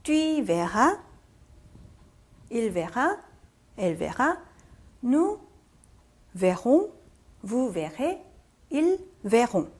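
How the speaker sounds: slow model reading with gaps between forms; slow demonstration. Shown as a demonstration. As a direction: draw out each form for students to repeat. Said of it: "자, 천천히 할게요". (천천히 한 마디씩 끊어 읽기)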